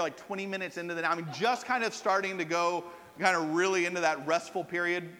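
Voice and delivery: normal talking voice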